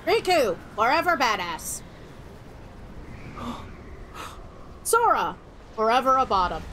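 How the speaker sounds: ordinary delivery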